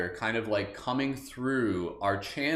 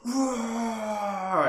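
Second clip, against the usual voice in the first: roar; 11 semitones higher than usual. Shown as a demonstration. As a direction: let it out like a dragon. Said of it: "and then we're gonna go".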